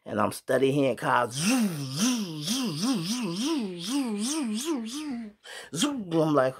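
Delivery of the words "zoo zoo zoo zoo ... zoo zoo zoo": high mock siren wail, zoo zoo; siren imitation. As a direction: copy a police siren going by, high and wailing. Imitating cars racing past outside.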